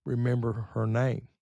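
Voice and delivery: ordinary talk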